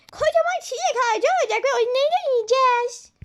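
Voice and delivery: put-on high, eager little-kid voice; high childish excitement; excited child. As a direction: dream out loud like a child. Child excited about tree.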